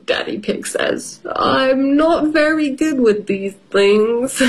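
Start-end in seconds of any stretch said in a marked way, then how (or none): 1.3-4.4 hesitant dad. Acted. Daddy voiced in story.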